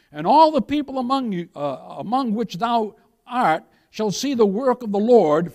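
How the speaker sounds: loud, forceful reading of God's promise; scripture reading. Acted. Preacher reading Exodus to the congregation, loud and forceful. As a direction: declaim the verses like a herald.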